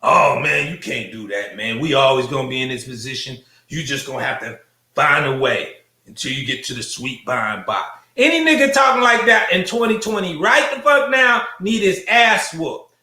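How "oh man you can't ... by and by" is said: mocking defeatist. Comedian mocks defeatist men he knows, low and mopey.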